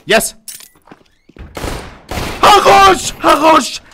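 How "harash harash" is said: excited shout. Real, not acted. Cheering a kill in game.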